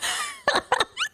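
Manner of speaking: high, soft giggling; high laugh. Real, not acted. Streamer amused by chat's compliment, high soft laugh. Laughs at chat praising their brain.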